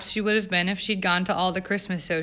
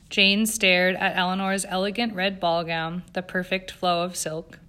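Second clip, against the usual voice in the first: ordinary delivery, nothing marked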